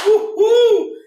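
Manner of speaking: high, loud woo-hoo; cheer. Real, not acted. Streamer cheers the new multiplayer reveal. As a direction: whoop with pure joy.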